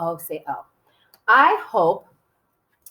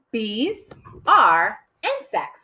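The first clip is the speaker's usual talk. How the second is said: very slow, each word set apart; much slower than usual. Shown as a demonstration. Teacher counting out a short first sentence, word by word. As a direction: dictate it slowly, word by word. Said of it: "It's only three words".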